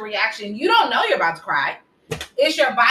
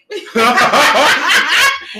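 loud laugh; 3 semitones higher, 7 dB louder than usual. Real, not acted.

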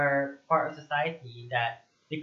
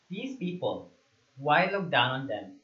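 ordinary talk, nothing marked

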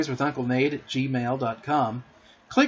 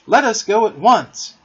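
loud, high, emphatic urging; excited cry; 7 semitones higher, 8 dB louder than usual. A narrator's reading. John grabs his Sunday hat, excited cry. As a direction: urge everyone off with great eagerness. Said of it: "cried John".

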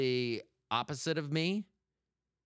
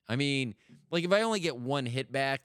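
ordinary talk

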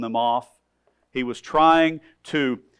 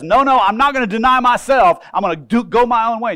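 raised, stubborn no-no; a defiant person brushing off Jesus, loud refusal; 7 semitones higher, 6 dB louder than usual. Retold or imitated. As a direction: push back loudly, stubborn. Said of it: "for that one who says".

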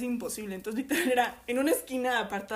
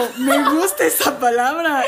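loud, higher mock delight; delighted director; 4 semitones higher, 11 dB louder than usual. Guest imitates the director hearing Spanish caramelo, loudly delighted. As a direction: imitate the director falling for a new word.

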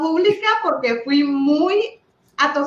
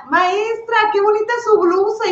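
put-on high, sweet little girl's voice; a schoolgirl complimenting her teacher's blouse, high and sweet; 9 semitones higher than usual. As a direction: pipe it up like an admiring child. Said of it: "me dice una niña".

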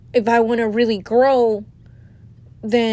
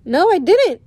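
retold surprised denial; 8 semitones higher, 6 dB louder than usual. Retells denying she knew.

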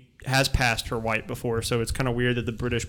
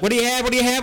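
Southern waitress; 10 semitones higher, 4 dB louder, much faster than usual. Pretend. Mock waitress exchange.

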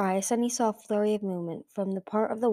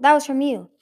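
louder, higher snarled accusation; 6 semitones higher, 8 dB louder than usual. A narrator's reading. Dragon queen confronting her sister, snarling. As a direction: accuse her with sudden realization. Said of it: "Burn snarled".

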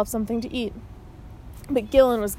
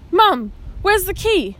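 anxious; 8 semitones higher, 3 dB louder, slower than usual. A narrator's reading. Sudden fear for lost key.

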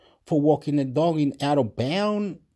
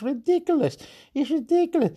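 exasperated outburst; 13 semitones higher than usual. Real, not acted.

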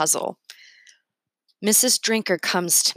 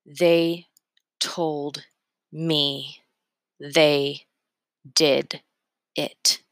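one word at a time, long pauses between; clear and slow; 2 semitones lower, much slower than usual. A narrator's reading. Corey telling the officer what he heard, slow and clear. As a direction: spell it out word by separate word. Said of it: "So clear, so slow".

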